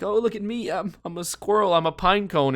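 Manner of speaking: playful squirrel imitation. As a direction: put on a high, silly show-off voice. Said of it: "maybe he's pretending to be a squirrel".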